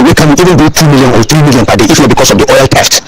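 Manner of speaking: ordinary delivery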